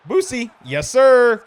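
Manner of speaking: high-pitched whoops for the runner; hyped-up gamer celebrating a score; excited cheering. Real, not acted. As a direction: cheer on a breakaway touchdown. Cheering a touchdown run.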